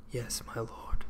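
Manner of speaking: hushed, voiceless yes; whispered submission. Acted. Knight James giving way to the king, a hushed whisper. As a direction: whisper your surrender.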